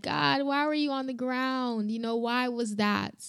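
soft, plaintive question to God; asking God. Retold or imitated. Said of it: "You know, I asked God".

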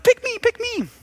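high-pitched eager chant; amped excited kids. Acted. Preschoolers answer the coach, excited high pleading. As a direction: beg to be chosen like little kids. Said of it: "they're excited and they're amped".

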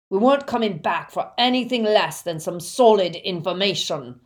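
rough Death Eater raging; angry roar. A narrator's reading. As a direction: berate the victim at full volume. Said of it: "roared the Death Eater".

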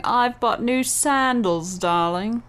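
slow, haughty posh-lady drawl; snobby voice. Pretend. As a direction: say it like a snob showing off. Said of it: "a snobby way of saying".

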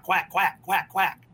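spoken quack sounds like a duck; mock duck quacking. [name] quacking around ring.

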